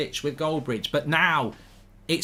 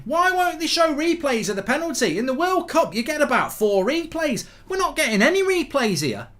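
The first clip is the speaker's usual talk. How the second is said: mock outrage; 9 semitones higher than usual. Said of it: "I'm fuming".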